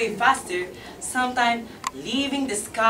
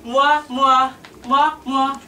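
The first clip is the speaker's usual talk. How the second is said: loud smacking kisses, mwah mwah; kissing sounds; 3 semitones higher, 6 dB louder, much slower than usual. A narrator's reading. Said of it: "he kissed them".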